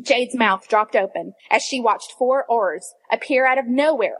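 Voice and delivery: ordinary delivery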